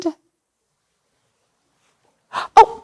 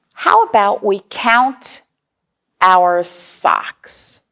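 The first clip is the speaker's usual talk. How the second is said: usual speaking voice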